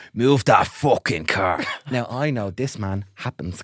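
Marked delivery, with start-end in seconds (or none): 0.1-1.6 menacing neighbour. Retold or imitated. Neighbour threatening up close.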